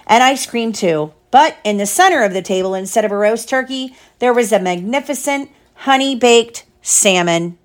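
plain reading voice

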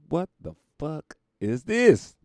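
slow, loud, high disbelief; outraged yell. Retold or imitated. Watching the All-Star game, loud outraged yell. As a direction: bellow it at the TV in disgust. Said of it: "I was like".

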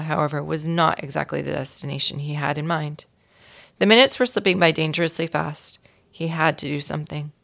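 usual speaking voice

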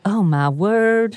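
hammed-up Southern belle; Blanche Devereaux. Playing O J as Blanche for the cohost, slow Southern drawl. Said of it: "your impression of Blanche Devereaux and O J are the same".

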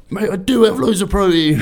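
put-on drunk self. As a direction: proclaim it drunk after six pints. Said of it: "six pints later".

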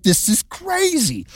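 high, slow, stunned; stunned disbelief. Retold or imitated. Joseph reacts to Mary's news in high, slow disbelief. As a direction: drag it out in mock disbelief. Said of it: "you're like".

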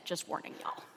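a quick unvoiced whisper to the room; conspiratorial whispered heads-up; whispered aside. Real, not acted. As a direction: lean in and whisper the warning. Aside about allergies and water.

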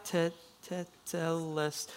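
te-, teteles- softly and slowly sounded out; broken-up pronunciation. Shown as a demonstration. Preacher works through the Greek word, slowly sounding out teteles-. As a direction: feel out teteles- piece by piece, breaking off. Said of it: "It's pronounced".

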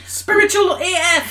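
high, loud playful outburst; excited exclamation. Real, not acted. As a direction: blurt it out with glee. Joking exclamation finishing sentence.

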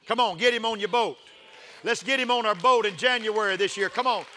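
loud, high shouted preaching; preaching at full force. Acted.

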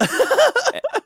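high-pitched burst of laughter. Real, not acted. Laugh after repeat.

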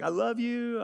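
gushing love you. Retold or imitated. Husband gushing.

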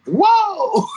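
high, stretched-out whoa of awe; amazed whoa. Retold or imitated. Kid seeing Stevie walk in for the first time, awed whoa. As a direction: let the whoa fly up high in wonder. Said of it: "I'm like".

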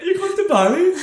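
put-on high, loud, amazed girly squeal with a laugh; gushing, laughing surprise; excited girls. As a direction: mimic their thrilled disbelief. Repeats girls' reaction.

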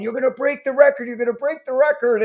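calling out to the runner, half-shouted; retold yelling. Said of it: "I'm yelling at him".

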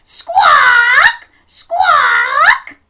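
very high, loud screeching squawks; loud parrot squawking for children. Shown as a demonstration. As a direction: shriek like a parrot.

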